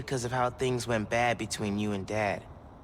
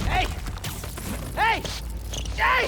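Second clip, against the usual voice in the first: calling out; slower than usual. Acted. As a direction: shout after her to stop. Calling after fleeing Phin.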